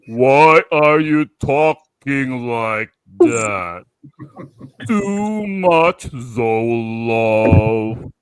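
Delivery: very slow, drawn-out trippy voice; hallucinating. Acted. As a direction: talk in slow motion, as if delirious. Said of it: "you feel like you're hallucinating".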